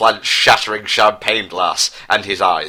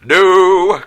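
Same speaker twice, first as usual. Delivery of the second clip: high, loud anguished no; anguished cry; 10 semitones higher, 7 dB louder than usual. Acted. Prince sees his brother's death, loud anguished cry. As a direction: cry out in grief and rage. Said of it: "cried out Prince Harry in sadness, anger".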